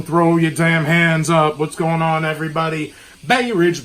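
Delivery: ordinary talk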